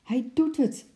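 high, excited exclamation; high-pitched thrilled call. A narrator's reading. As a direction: cry it out in happy amazement. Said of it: "riep moeder".

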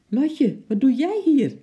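high, loud, startled call of a name; mother caught off guard, calling out sharply; surprised call. A narrator's reading. Mother surprised to find Lotje in the kitchen, calling out loud and high. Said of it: "riep moeder verbaasd".

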